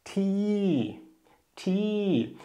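teacher models "Ti" on the falling tone; falling tone Thai. Shown as a demonstration. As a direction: let "Ti" fall from a high start. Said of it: "ändert es sich wieder in fallend".

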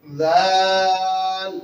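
জাল held very long and loud; drawn-out letter name. Shown as a demonstration. Teacher dictating a letter to the class: long loud জাল. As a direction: stretch জাল out slowly for dictation. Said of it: "আবার উচ্চারণ করবেন".

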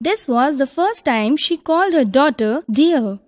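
ordinary delivery, nothing marked

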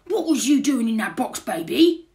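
loud, high burglar questioning; booming incredulous question; astonished burglar. A narrator's reading. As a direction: demand it in amazement. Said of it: "he says".

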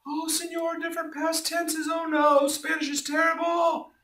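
high squeaky mock student wail; whiny student.

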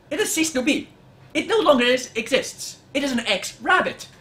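mock-solemn rabbit eulogy, quoting Monty Python; a hint of the dead parrot rant; Monty Python quote. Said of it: "I've now quoted Monty Python".

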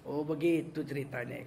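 ordinary talk

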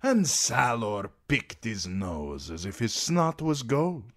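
slow, showy and grand; flamboyant sellsword. A narrator's reading. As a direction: lay on the charm, drawn out. Daario voice.

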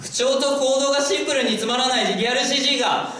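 rising into a full exasperated shout. Real, not acted. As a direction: yell it out in comic frustration. Exasperated comic outburst.

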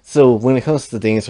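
ordinary talk, nothing marked

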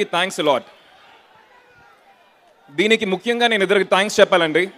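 usual speaking voice

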